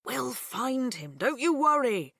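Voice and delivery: slow, warm dad; reassuring. A narrator's reading. Dad reassuring his daughter, slow and warm. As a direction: promise it kindly. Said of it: "in his bright and warm voice".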